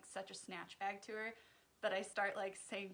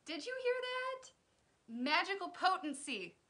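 high, loud incredulous outburst; amazed disbelief; 10 dB louder than usual. Real, not acted. Vlogger reacts to Alexa's surprising definition, amazed disbelief. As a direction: react as if you can't believe your ears.

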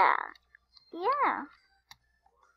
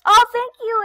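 surprised; 11 semitones higher, 14 dB louder than usual. Real, not acted. Receiving a gift.

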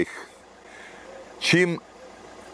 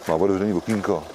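ordinary delivery

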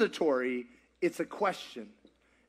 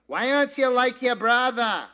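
a hint of a scolding parent, raised and louder; mock accusing parent; 5 semitones higher, 5 dB louder than usual. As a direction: throw the comparison at them like a nagging parent. Example of comparing.

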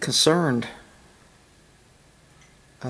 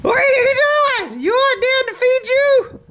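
put-on pet baby talk; 3 dB louder than usual. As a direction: coo at the horse like a baby.

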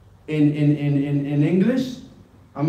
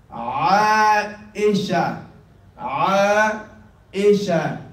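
ordinary delivery

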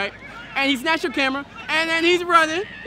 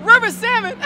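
higher and louder echo; mocking disbelief; 6 semitones higher, 8 dB louder than usual. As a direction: repeat it back in scornful shock. Mocking incredulity.

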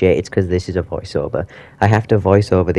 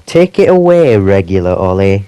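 hype handoff; 9 dB louder than usual. Real, not acted. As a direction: hand the show over like a host. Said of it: "so I don't have to do the voiceover anymore".

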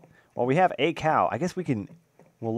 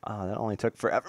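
usual speaking voice

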